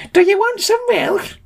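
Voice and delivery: very high screechy milk seller; piercing high character voice; Frau Milk. A narrator's reading. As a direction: squeal the offer in a very high voice. Frau Milk's shrieked character voice.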